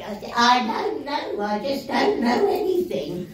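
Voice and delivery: slow, muddled, lightly played very old lady; doddery old self. Imagining herself still at conventions very old, slow and befuddled. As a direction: drag the words out like a bewildered old woman. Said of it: "I'll be coming back here".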